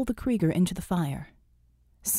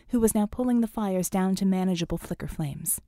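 usual speaking voice

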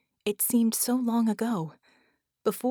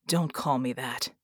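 low, understated snap; a touch of anger, kept low; snapped; 6 semitones lower than usual. A narrator's reading. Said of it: "they snapped".

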